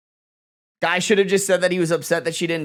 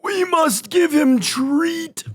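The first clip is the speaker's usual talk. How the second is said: much higher, slow put-on voice; silly pet voice; 13 semitones higher, 3 dB louder, much slower than usual. Real, not acted. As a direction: say it like a goofy cartoon. Doting on the cat.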